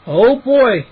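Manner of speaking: usual speaking voice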